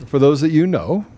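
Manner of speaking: ordinary talk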